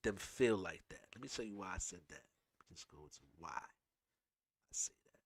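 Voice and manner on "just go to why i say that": whispered aside to himself; half-whispered note to self. Real, not acted. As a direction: mutter it under the breath. Muttering to himself while finding clip.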